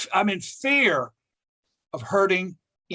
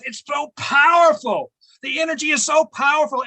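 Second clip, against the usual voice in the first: ecstatic shout; 8 semitones higher, 5 dB louder than usual. Real, not acted. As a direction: shout the wonder of it out. Ecstatic about birth and death.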